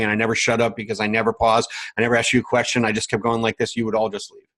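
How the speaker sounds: rapid-fire, run-on monotone with no pauses; talking really fast. Shown as a demonstration. Coach shows the group how nonstop talk loses listeners, really fast. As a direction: rattle it off nonstop, leaving no space to think. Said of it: "If all I did was talk really fast".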